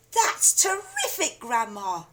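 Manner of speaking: put-on high, thrilled boy's cry; excited cry. A narrator's reading. Boy amazed at grandma standing, excited cry. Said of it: "George cried".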